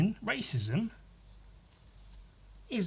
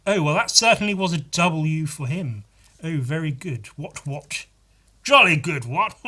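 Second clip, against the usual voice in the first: mock upper-class British accent, higher and louder; posh British accent; 4 semitones higher, 5 dB louder than usual. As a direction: ham up a jolly-good British gent. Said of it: "in a British accent".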